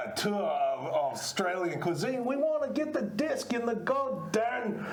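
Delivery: hammed-up Australian accent; Aussie accent. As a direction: play it as a comic Aussie. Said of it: "Give us an Aussie accent".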